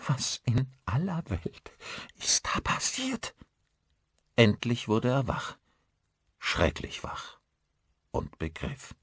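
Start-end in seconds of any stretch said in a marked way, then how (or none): 0.1-3.4 slow, breathy frightened question, climbing high; trembling voice. A narrator's reading. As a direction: falter through it in a trembling half-whisper. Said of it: "sagte Caro mit zitternder Stimme". (zitternd, gehaucht und verängstigt)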